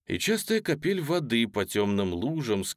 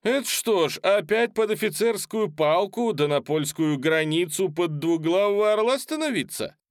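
put-on young soldier voice; 11 semitones higher, 4 dB louder than usual. A narrator's reading. Young cossack heckles orator.

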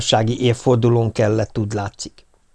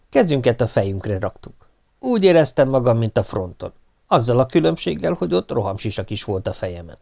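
ordinary talk

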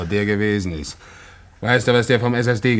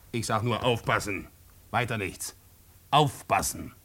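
quiet warning; 8 dB quieter than usual. Acted. Informer giving a veiled warning.

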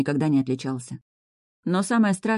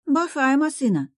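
put-on little girl voice; 11 semitones higher than usual. Little daughter admiring the car.

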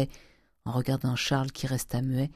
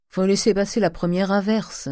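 put-on higher-pitched, dismissive old miser; offhand uncle; 6 semitones higher, 3 dB louder than usual. Uncle dismissing his nephew's weeping, offhand. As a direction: shrug off the tears in a raised voice. Oncle rentre, commente les pleurs.

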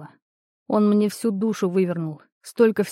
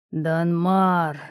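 demonic whisper. A narrator's reading. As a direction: call the name like a demon haunting him. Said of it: "пульсирует в голове голос демона".

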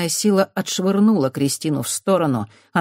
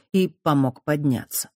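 ordinary delivery

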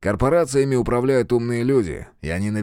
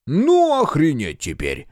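slow exasperated curse, a bit louder; swearing; 6 semitones higher, 3 dB louder, slower than usual. Acted. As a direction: spit out the curse in disbelief. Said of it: "выругался Квадр".

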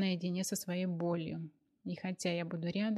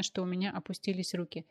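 ordinary delivery

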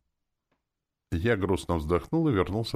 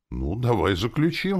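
higher, louder eager offer; exclaiming; 8 semitones higher, 5 dB louder than usual. A narrator's reading. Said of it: "воскликнул я".

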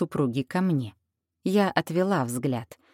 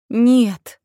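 higher, louder cry of denial; horrified denial; 6 semitones higher, 9 dB louder than usual. Acted. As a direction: recoil in horror. Heroine's horrified thought.

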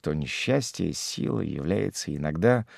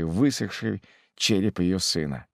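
usual speaking voice

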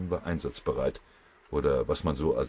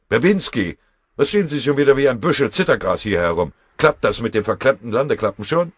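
loud, higher-pitched telling-off; angry scolding; 5 semitones higher, 8 dB louder than usual. A narrator's reading. Foreman to freezing mechanics hanging round the stove, angry scolding. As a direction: bawl out the idle crew.

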